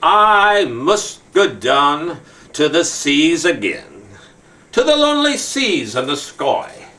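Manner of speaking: slow, dramatic poem reading with long pauses; poem recitation. Acted. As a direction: recite it like a grand sea poem.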